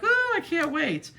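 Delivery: high, slow, gushing mock delight; gushing excitement. Telling the breeder how thrilled she is, high and gushing. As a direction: stretch it out like she can't contain herself. Retold own reply to breeder.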